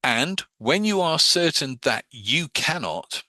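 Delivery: drawn out to embed the command; slow suggestion. Shown as a demonstration. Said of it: "I'm telling you that you cannot".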